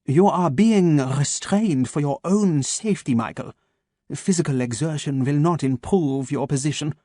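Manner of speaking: measured German doctor, each word placed; a calm German voice waking his captive; German accent. Acted. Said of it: "his German accent crisp and sharp".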